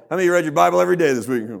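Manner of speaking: loud, rushed, high question; mock interrogation. Preacher mimicking one who quizzes the congregation, loud and pressing. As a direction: fire the question loudly at the whole room. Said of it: "go around the room and ask".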